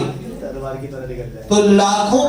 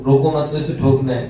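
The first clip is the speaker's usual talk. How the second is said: ordinary talk, nothing marked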